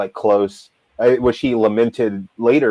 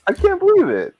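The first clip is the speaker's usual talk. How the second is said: very high-pitched, exasperated disbelief; incredulous. Real, not acted. Fan still stuck on the blunder as host moves on, incredulous.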